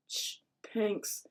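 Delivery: embarrassed thanks under the breath; muttering. A narrator's reading. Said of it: "mutters Frank".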